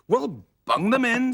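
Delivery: high, brisk; Sooty's chirpy voice. A narrator's reading. Sooty chirps to Scampy over the voice box. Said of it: "said Sooty".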